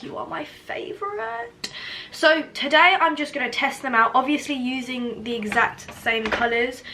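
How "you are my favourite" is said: put-on high, soft baby talk; cooing. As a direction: coo it to a beloved pet. Cooing to favourite palette.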